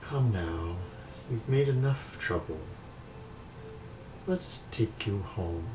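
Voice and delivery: soft, unhurried spirit voice; hushed, eerie coaxing; mysterious voice. Acted. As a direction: gently summon them home like a ghost. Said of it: "Just the voice is like".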